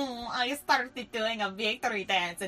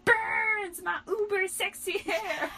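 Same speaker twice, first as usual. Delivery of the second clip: whiny vain Romeo; 11 semitones higher, 4 dB louder, slower than usual. Acted. Riku whining about hair again.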